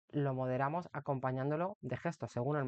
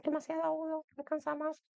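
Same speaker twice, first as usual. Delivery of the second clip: squeaky very high voice; showing an overly high voice; too high-pitched; 13 semitones higher than usual. Shown as a demonstration. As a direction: demo a shrill high tone that tires the ear. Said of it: "utilizáis un tono demasiado agudo".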